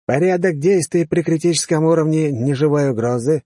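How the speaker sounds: commanding officer. Acted. Officer asks next cadet.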